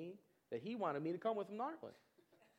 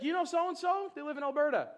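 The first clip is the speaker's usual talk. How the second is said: high, loud, chatty mock Canadian; hammed-up mock local, much higher and louder; mimicking a clueless Canadian; 8 semitones higher, 10 dB louder than usual. Mocking locals who lump all Canada together. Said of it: "it's like".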